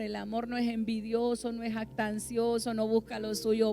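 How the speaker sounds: loud, building preaching; fiery preaching. Acted. Preacher building to a loud climax on love. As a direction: preach love's traits with rising force.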